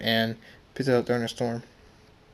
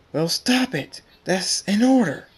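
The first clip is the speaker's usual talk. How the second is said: raised, louder angry order; shouted command; 8 semitones higher, 7 dB louder than usual. Acted. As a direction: order them to stop, voice climbing. Ordering two fighters to stop.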